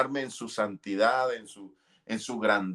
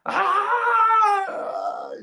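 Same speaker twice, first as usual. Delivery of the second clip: a piercing high cry of despair; retold scream of despair; anguished scream; 5 dB louder than usual. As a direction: let out a loud, desperate wail. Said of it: "gritaba desesperado".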